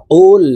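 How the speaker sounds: কুল with the qaf from the back of the tongue on the palate; deep-throated qaf. Shown as a demonstration. Teacher to viewers, demonstrating the correct deep কুল. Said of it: "বলতে হবে এইভাবে".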